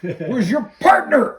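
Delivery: high, slow and loud taunt; loud mocking taunt. Quoting villain taunting cop.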